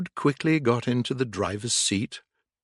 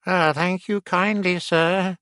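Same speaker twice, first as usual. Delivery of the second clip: weak voice; 7 semitones higher than usual. A narrator's reading. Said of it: "said Toad in a weak voice".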